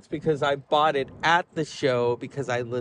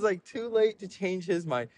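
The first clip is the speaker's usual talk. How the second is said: ordinary talk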